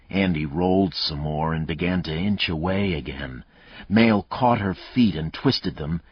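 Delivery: ordinary delivery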